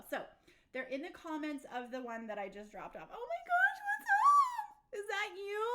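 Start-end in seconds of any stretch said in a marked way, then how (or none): 3.1-5.6 delighted surprise. Real, not acted. Sees a friend's old photo.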